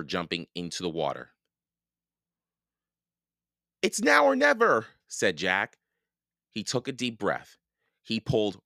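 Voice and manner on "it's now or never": high, loud rallying cry; urgent resolve. A narrator's reading. Jack before the last hard pull, urgent. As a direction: belt it out high, all or nothing. Decisive moment of rescue.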